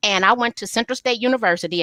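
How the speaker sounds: usual speaking voice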